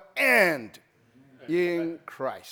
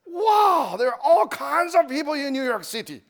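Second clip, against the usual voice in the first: amazed; 6 semitones higher, 3 dB louder than usual. Retold or imitated. Amazed at New York.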